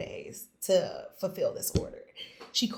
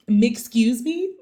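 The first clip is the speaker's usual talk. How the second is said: louder, slow, laughing Excuse me?; laughing disbelief; 5 semitones higher, 10 dB louder, slower than usual. Retold or imitated. Hearing the client is Netflix.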